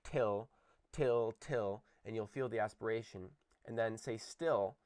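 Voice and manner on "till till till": "till" with a puff of air after the t; aspirated till. Shown as a demonstration. Teacher showing students how to feel aspiration. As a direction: breathe out on the t of "till". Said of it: "say the word".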